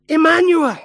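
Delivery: a triumphant call of Emmanuel; proclaimed. Real, not acted. Climax naming God with us.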